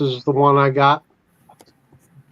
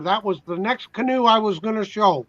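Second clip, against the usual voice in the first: mouth full; 7 semitones higher than usual. Real, not acted. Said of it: "Caught me with a mouthful of food".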